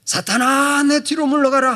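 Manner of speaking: high, loud rebuke; preacher showing how to shout Satan away; commanding shout. Shown as a demonstration. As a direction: cast the devil out with full force. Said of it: "하고 소리치고".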